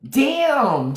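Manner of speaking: "Damn" pitched high and drawn out; a high mock-shocked "Damn"; exaggerated damn. Real, not acted. Reacting to a viewer's comment, mock shocked.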